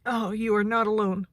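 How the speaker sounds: normal talking voice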